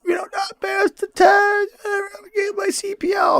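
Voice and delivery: very high, wailing mock cry; crying lady. Imitating the crying lady at CPL training in a high wail. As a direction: whine and sob it out like a panicked student. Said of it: "And she's crying".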